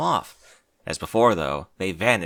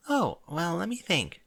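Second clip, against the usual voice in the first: timid Fluttershy voice; 8 semitones higher, 3 dB quieter than usual. Acted.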